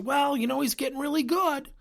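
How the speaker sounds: smooth it over to please the parent; placating. Retold or imitated. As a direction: coach dodging conflict by placating the parent. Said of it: "if I don't handle the conflict".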